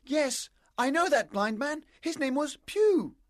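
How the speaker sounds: put-on high, urgent boyish voice; agitated and high-pitched; excited boy. A narrator's reading. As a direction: blurt out what you know. Boy excitedly accuses the pirate.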